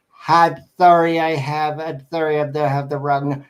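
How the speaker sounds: imitated American old lady on the phone; old lady caller. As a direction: put on a fumbling, repeating old woman's apology. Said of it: "it's another American old lady".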